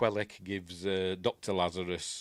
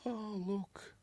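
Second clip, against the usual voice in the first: much higher, soft doting coo; cooing woman; 12 semitones higher, 6 dB quieter than usual. Retold or imitated. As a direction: coo it at the hurt little creature. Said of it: "she's like".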